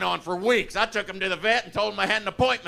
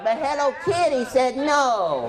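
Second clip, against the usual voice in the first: meek tattoo boast; 7 semitones higher, slower than usual. Retold or imitated. Weak tattoo at summit.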